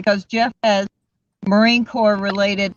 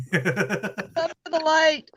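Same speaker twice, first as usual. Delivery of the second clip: slow invitation through laughter; laughing, slow; 4 semitones higher, 6 dB quieter, much slower than usual. Pretend. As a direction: laugh it out, drawn out. Teasing Casey to join.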